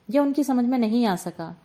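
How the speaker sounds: plain reading voice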